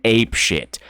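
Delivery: ordinary talk, nothing marked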